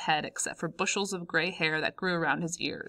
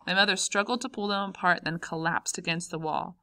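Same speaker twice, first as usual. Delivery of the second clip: plain reading voice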